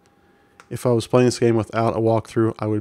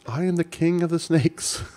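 a lightly voiced snake king, a touch higher and grand; 6 semitones higher than usual. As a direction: play the snake king announcing himself, half in jest. Reading the snake king's line.

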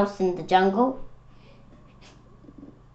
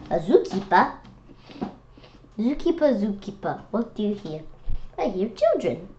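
plain reading voice

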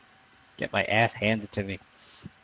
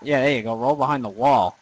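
normal talking voice

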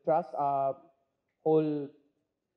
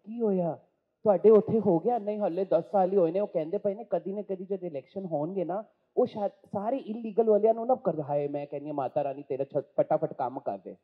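fast, loud gossiping auntie; Punjabi auntie voice; 3 semitones higher, 6 dB louder, much faster than usual. Acted. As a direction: rattle it off like a nosy Punjabi aunt. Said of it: "if you ask Pammi auntie".

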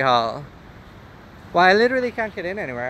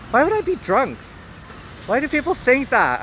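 indignant; 9 semitones higher than usual. Real, not acted. Indignant at chat.

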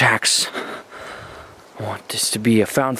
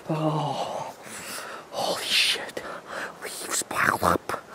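hushed whisper, slower on the last words; scared whisper; 8 dB quieter, slower than usual. Acted. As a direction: whisper it as if the witch might hear.

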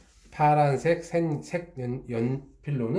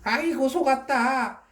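dismayed wail; 12 semitones higher than usual. Acted. Fox realizing the raccoon tricked it, high dismayed wail.

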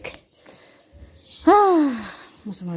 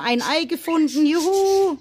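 delighted cheer; 6 semitones higher than usual. Real, not acted. Finds an egg.

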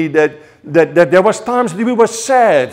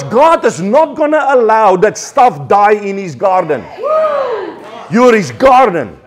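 rising, louder preaching that builds to a shout; fiery preaching; 4 semitones higher, 5 dB louder than usual. Acted. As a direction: preach it with fire, building to the climax.

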